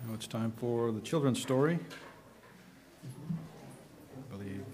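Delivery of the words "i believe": ordinary talk, nothing marked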